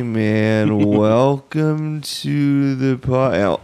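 very slow, stretched-out stoner greeting; stoned half-speed voice. Retold or imitated. Host imitates their podcast at half speed, stoned drawl. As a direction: drag every word like half-speed playback. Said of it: "sounds like we are stoned".

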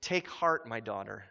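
hushed loving reassurance; tender Jesus. Retold or imitated. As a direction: comfort her like a gentle father. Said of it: "what does he say".

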